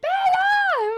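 high, loud mock mom yell; freaking-out mom. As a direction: imitate a frantic mother calling out. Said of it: "freaking out".

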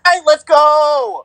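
high, drawn-out cheer with laughter; celebratory shout. Real, not acted. Co-host cheering her for finally getting the name, celebratory shout. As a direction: whoop it up like a big win.

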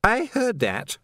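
high, sharp woman's retort; a different woman cutting in sharply; sharp female voice. A narrator's reading. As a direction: snap it back, indignant. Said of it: "said another female voice sharply".

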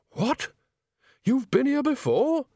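high, incredulous squawk; shocked. A narrator's reading. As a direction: gasp it in disbelief. Nanny shocked.

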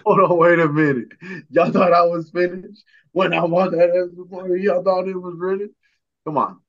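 loud, high hype-up shouting; hyped up. Real, not acted. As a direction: get the whole room hyped. Hyped-up boast after hype song.

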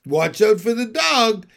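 high, loud alarm call; urgent sheep shouting danger; cried warning. A narrator's reading. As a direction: cry out a warning. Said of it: "cried the sheep".

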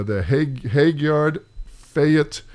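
normal talking voice

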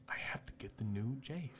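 breathy whispered aside. Retold or imitated. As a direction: whisper it like a secret confession. Voicing a broke parent.

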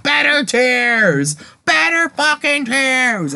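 high, slow theatrical anger; pissed off. Retold or imitated. Imitating Ozymandias demanding better tears from the maid, hammed-up anger. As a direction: imitate an angry director, stretching each word out. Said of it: "he's pissed off".

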